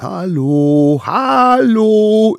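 high, loud repeated hallo; calling out. A narrator's reading. Little wild ones calling over the meadows. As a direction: call out again and again. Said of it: "immer wieder".

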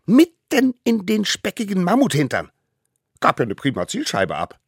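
put-on high cheeky child, gloating; proud boast. A narrator's reading. Smallest savage brags about his target, gloating. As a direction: crow about the hit with hunter's pride. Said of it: "nicht ohne Jägerstolz".

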